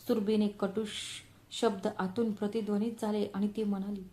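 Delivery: ordinary delivery, nothing marked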